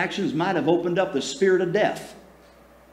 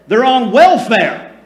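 higher, louder indignant mock outrage; mocking critics; 7 semitones higher, 9 dB louder than usual.

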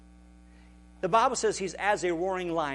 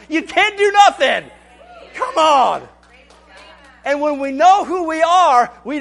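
loud, high, shouted preaching; a preacher roaring that the devil is powerless; full-force preaching; 9 semitones higher, 14 dB louder than usual. Acted. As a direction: shout it to the rafters like a revival preacher.

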